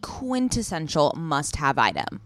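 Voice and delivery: loud, enthusiastic sponsor read. Acted. Host pitching a clothing brand to listeners, punchy ad read. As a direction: sell it with punchy emphasis.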